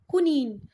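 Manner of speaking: "कुनीन" said loud and firm as koo-NEEN with a long ee; correct pronunciation. Shown as a demonstration. Teacher stressing the exact pronunciation "कुनीन" to her students, loud and firm. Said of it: "पर exact जो उसका pronunciation है वो है कुनीन".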